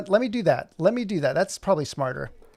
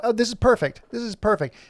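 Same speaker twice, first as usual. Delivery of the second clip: excited; 7 semitones higher, 4 dB louder than usual. Real, not acted. Delighted the pivot shot works.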